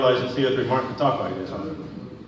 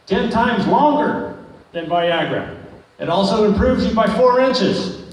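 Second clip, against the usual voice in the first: mock ad pitch; 6 semitones higher, slower than usual. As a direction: sell it like a TV drug ad, hammed up.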